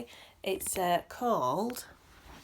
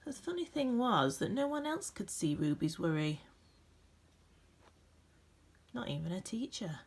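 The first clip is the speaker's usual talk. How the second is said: gentle, expressive storybook reading with dramatic pauses; reading to children; 3 dB louder than usual. A narrator's reading. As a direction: read it like a bedtime picture book for a class. Reads picture book aloud.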